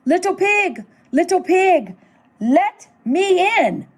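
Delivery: high singsong call at the door. A narrator's reading. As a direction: hail someone inside the house. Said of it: "so I called".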